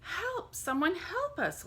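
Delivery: high panicked cry for help; wailing. A narrator's reading. Animals crying out for help, high and scared. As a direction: wail in panic. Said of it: "wailed the animals".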